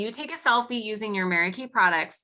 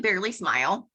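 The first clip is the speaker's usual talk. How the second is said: ordinary talk, nothing marked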